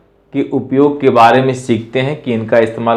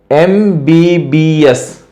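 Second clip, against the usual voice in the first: slow spelling; much slower than usual. Shown as a demonstration. Teacher spelling out एम बी बी एस to the class, very slowly. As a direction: spell एम बी बी एस very slowly, each letter apart. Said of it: "एम का अगर उच्चारण देखिए ए म".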